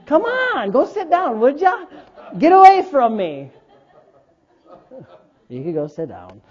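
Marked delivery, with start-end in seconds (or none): 0.1-3.5 high-pitched playful fending-off; mock protest. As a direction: pretend to shoo a child away. Acts out pushing child away.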